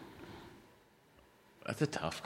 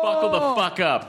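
high, drawn-out hype; hyped anticipation; 11 semitones higher, slower than usual. Acted. Bracing friends for the gossip, high drawn-out hype. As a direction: brace everyone for a wild story. Hyping the coming story.

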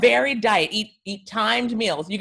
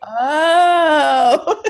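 high laughing oh; laughing cry; 7 semitones higher, 8 dB louder than usual. Retold or imitated. Friend learning the cheese rule, laughing. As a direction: crack up in dismay.

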